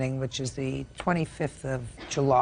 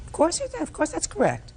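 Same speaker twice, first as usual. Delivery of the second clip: high incredulous scolding retort; sarcastic scolding tv judge; 12 semitones higher than usual. Real, not acted. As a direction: cut off the denial, scoffing. Judge scolding a false denial.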